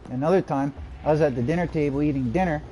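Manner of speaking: usual speaking voice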